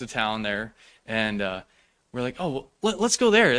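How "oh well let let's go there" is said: eager. Retold or imitated. Deciding on an unplanned hotel.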